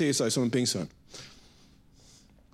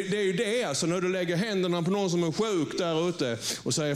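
ordinary talk

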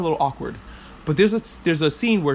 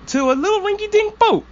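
high-pitched sing-out ending on a squeal; squeaky mock-innocent build-up; mock-cutesy; 3 dB louder than usual. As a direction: play it up as silly and tiny, pitch shooting up. Mock-cutesy set-up for battleship joke.

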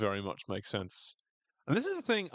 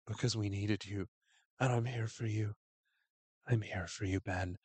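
softer, eerie caring voice; creepy soft quote; 3 dB quieter than usual. Retold or imitated. Misty comforting Coach Ben, softly creepy and devoted. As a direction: say it sweetly, with hidden menace. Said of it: "absolutely fucking terrifying line read".

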